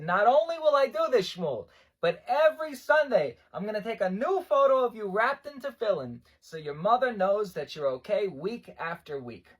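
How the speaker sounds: loud, high, rousing promise; emphatic rabbi. Retold or imitated. Rabbi vowing weekly photos for a mother, emphatic. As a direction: declare it with growing generosity.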